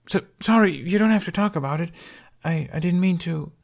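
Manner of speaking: put-on high, fast, anxious little boy; a kid scrambling to say sorry; nervous young boy; flustered apology.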